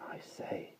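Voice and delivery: hushed, worried whisper; anxious whisper. A narrator's reading. Boy whispering anxiously to his sister. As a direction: whisper it nervously, afraid to be heard. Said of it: "in an anxious whisper".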